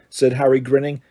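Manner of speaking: usual speaking voice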